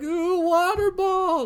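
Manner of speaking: high, slow, thrilled squeal; excited. Retold or imitated. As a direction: gasp with childish delight. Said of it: "so excited".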